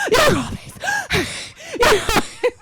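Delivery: loud, high wheezing gasps; exaggerated huffing and puffing; mock panting. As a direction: act out frantic breathlessness on the bike. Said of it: "I'm panting really".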